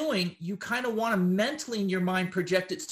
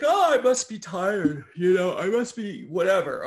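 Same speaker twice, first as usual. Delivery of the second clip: drowsy shrug; 6 dB louder than usual. Retold or imitated. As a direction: play the yawner who shrugs it off as tiredness. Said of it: "we're just like".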